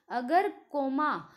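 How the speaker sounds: ordinary talk, nothing marked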